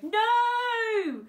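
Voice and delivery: long, high, loud wail; desperate shout. A narrator's reading. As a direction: cry out in protest at losing Dad. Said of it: "shouted Frank".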